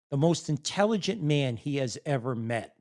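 ordinary talk, nothing marked

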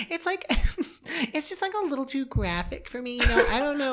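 high prim lady voice through laughter; prissy prim voice. Pretend. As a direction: pitch way up and act scandalised. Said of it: "doing that voice".

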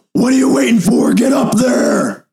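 high, loud barking command; intimidating shout. Acted. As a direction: yell at them to hurry. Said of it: "Korath is gonna be like".

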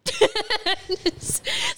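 real laugh rising high; high burst of laughter. Real, not acted. Laughing at wearing a kids' belt.

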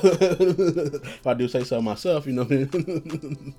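slow, chuckling self-praise; laughing. Real, not acted. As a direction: laugh through the words, taking it slowly.

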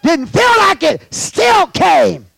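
high, loud, punched phrases; emphatic preaching shout. Real, not acted. Preacher testifies he came regardless, emphatic shout.

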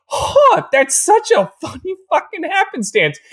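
very high, sarcastic fake surprise; mock amazement. Podcaster mocking the Vances' convenient offer, fake astonishment. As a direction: pretend to marvel at the coincidence. Mock reaction to unlikely coincidence.

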